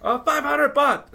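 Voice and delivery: very high, loud vendor voice; name the price in a squeaky hawker's voice; vendor quoting a price. Retold or imitated. Vendor naming his price to the tourist, high and loud.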